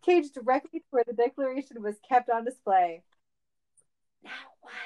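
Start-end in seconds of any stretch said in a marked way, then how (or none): 4.2-4.7 very hushed, furtive question; whisper. A narrator's reading. Sonic whispering to Cage in the archives, whispered. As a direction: whisper it under your breath. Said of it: "Sonic whispered".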